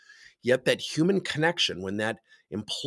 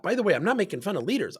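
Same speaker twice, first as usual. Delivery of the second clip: ordinary talk, nothing marked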